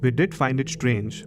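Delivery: normal talking voice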